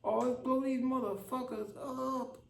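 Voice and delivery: hammed-up warmonger. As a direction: stretch it out in a squeaky cartoon threat. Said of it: "a real".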